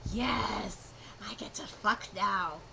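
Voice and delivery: low, soft lecherous mutter; a lightly voiced gloating goblin; leering goblin thought. Goblin gloating over the girl, low and soft. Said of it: "She's like".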